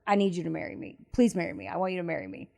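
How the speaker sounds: soft, hushed begging; a mild imitation of a man begging his ex; pleading. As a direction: quietly plead with her to marry you. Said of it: "he's begging her".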